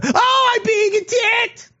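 very high, slow, hammed-up whimpering cry; mock fake victim. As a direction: squeal it like a pampered victim. Mocking politician as fake victim.

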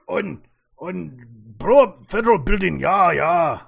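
loud, hammed-up German accent; a clumsy attempt at a German accent; bad German accent. Mocking the German suspect, in a bad German accent. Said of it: "I can't do a German accent".

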